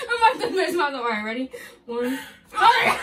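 high, loud laughing countdown. Real, not acted. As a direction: count through wild laughter. Laughing before rip.

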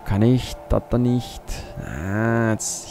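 ordinary delivery

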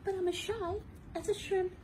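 high, small timid voice; high shy shrimp voice; shy little voice. Acted. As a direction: say it shyly and small.

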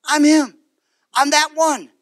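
proud Jesus. Retold or imitated. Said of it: "he says".

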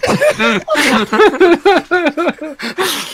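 a big burst of helpless laughing; loud high laughter. Real, not acted. Gamer laughing at the Indian accent dare, loud high laughter.

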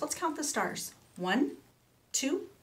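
ordinary delivery, nothing marked

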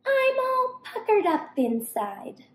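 high, sour-faced little monkey voice; high sour monkey complaint; monkey's puckered whine. Acted. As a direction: pucker up and squeak it out. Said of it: "She ate lemons till she cried".

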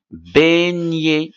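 "beignet" drawn out, final "et" said as an é sound. Shown as a demonstration. Teacher models a French word for pupils, final ET as é in "beignet". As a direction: stretch "beignet" slowly, ending on é with a silent t. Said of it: "E T aussi se prononce E".

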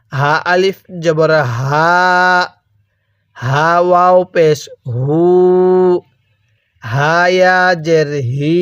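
হা with a light sound from the throat; slow, the হা voiced from the throat; throaty হা. Shown as a demonstration. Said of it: "গলার মধ্যে হালকা আওয়াজ করলে".

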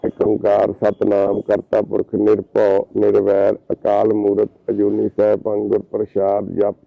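slow, low, full-voiced recitation of the Mool Mantar; prayer recitation. Visitor blesses the depressed young man with a slow recited prayer. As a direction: intone it slowly and devoutly, like at the gurdwara.

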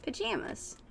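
pajamas with a short "a" as in jam; pronunciation answer. Shown as a demonstration. Confirming how it is pronounced, said softly. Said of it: "how do you pronounce that?".

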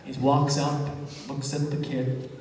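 ordinary talk, nothing marked

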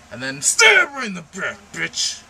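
high, loud outrage; indignant shout. Retold or imitated. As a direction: spit it out in disgust. Recounting hated game scene.

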